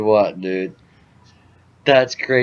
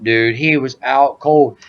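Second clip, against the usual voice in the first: normal talking voice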